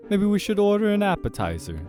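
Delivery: high, loud suggestion; loud, high-pitched dinner companion; eager dinner date. Acted. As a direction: pitch it up brightly to please. Companion addresses the demon.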